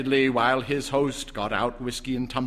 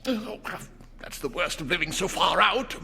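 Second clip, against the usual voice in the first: bawling angrily; 8 semitones higher than usual. A narrator's reading. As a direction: snap it out in a sudden rage.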